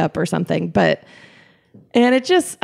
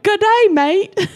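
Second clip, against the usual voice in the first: mock Australian accent, much higher and slow; imitating Australian announcers; 12 semitones higher than usual. Retelling announcers playing up the Australian accent, mock Australian accent. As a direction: ham up the Australian announcer's good day mate. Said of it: "played up the Australian accent".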